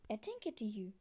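ordinary talk

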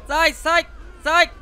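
loud, high frightened cries; panicked scream. Real, not acted. Gamer about to be run over by a car, panicked scream. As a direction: scream as the car comes at you.

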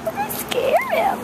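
put-on very high cooing baby talk; puppy voice. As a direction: squeak it at the dog in a tiny high voice. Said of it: "That's my puppy voice".

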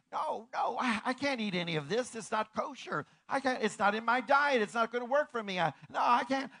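hammed-up Peter refusing; Peter protesting. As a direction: push back the food in alarm. Peter refusing, acted quarrel.

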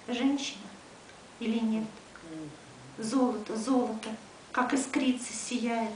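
measured poem recitation, endings dropping away; poetry reading. Acted. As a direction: address it as verse, pausing after each phrase. Reads another poem in Russian. (размеренная декламация стихов)

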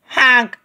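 loud high honk; big goose honk. A narrator's reading. The lost little goose honks loudly for his mother. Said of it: "he cried again, even louder".

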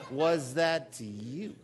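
slow, stressed WAS, rising at the end; led call-and-response. Shown as a demonstration. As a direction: say "Was that to you?" slowly, leaning on WAS. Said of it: "Try it again".

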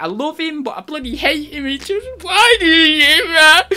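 high voice, building into a loud cry; mock conflicted sobbing. Imitating a heartbroken friend torn about her ex, high rising cry. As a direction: start high and torn, then wail the last line. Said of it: "you're like".

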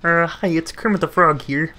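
a hint of the puppet frog; mild Kermit impression. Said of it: "I would do the Kermit the Frog voice".

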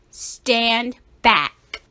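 ordinary delivery, nothing marked

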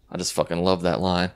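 usual speaking voice

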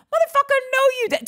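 high-pitched, indignant callout; indignant outburst. Acted. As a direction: burst out high, calling out a liar. Calling out his retold lie.

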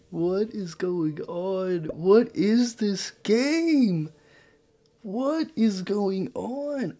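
slow exasperated groan of a question; drawn-out disbelief. Real, not acted. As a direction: drag out every word, baffled. Stunned by the game state.

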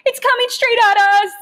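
high, loud panicked cry. A narrator's reading. Boy panicking as the rat charges. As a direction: blurt it in terror. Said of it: "I could not control my panic".

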